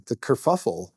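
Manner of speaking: ordinary delivery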